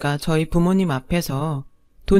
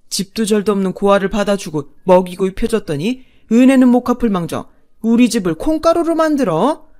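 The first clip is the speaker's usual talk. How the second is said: louder, bellowing rage; roaring outburst; 5 dB louder than usual. Acted. Father-in-law roaring at his son and wife, furious. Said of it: "아버님이 버럭 소리쳤죠".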